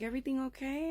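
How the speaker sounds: high, rising polite query; lightly imitated neighbor. Neighbor husband checks on family, high and polite. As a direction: lift it into a concerned question.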